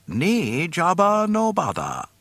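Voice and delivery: slow, loud alien speech; sly creature refusal; alien majordomo. A narrator's reading. Bib Fortuna speaks Huttese.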